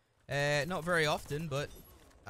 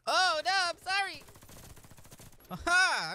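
mocking voice; 14 semitones higher, 5 dB louder than usual. Mocking high voice.

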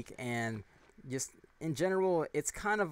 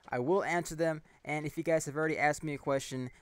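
ordinary talk, nothing marked

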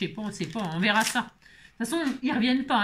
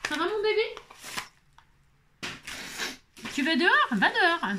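pet talk; 7 semitones higher than usual. Real, not acted. Talking to pet going outside.

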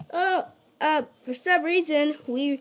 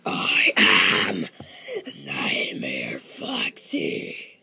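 low eerie whisper; much slower than usual. Acted. Dramatic villain reveal.

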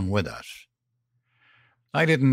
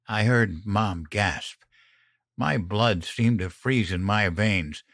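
usual speaking voice

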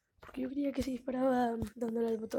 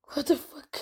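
shocked outburst; 4 semitones higher, 6 dB louder, slower than usual. Real, not acted.

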